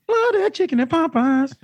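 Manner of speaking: Annie voice. Retold or imitated. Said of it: "it ends with".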